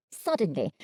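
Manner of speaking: ordinary delivery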